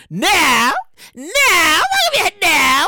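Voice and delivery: fake-sob it out, stretched; mock wail. Mock despair at reopening.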